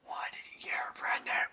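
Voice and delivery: hushed unvoiced whisper of disbelief. Viewer to the father on screen, aghast whisper. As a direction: whisper it like you're in the silent theater. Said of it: "it's like".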